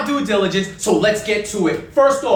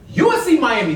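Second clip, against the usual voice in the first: ordinary talk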